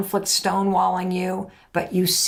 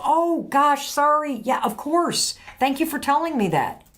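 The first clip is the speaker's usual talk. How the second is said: higher, quick, eager agreement; eager partner reply; 8 semitones higher than usual. Acted. Partner apologising to you, higher and quick. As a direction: play the partner brightly agreeing, pitched up.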